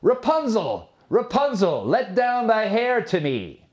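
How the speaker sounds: calling up. A narrator's reading. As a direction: call her name up the tower wall. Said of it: "when the king's son came and cried".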